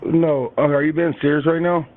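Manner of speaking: usual speaking voice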